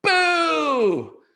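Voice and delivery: high loud boo. Acted. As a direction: shout it to scare. Lance scares the man.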